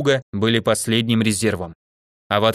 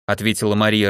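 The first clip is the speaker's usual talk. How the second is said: usual speaking voice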